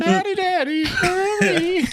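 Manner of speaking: put-on very high falsetto child; hammed-up falsetto plea; mock child's cry. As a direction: squeal it like a pleading little kid. Mimics a child in a sung parody.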